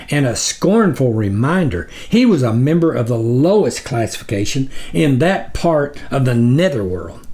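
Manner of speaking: ordinary delivery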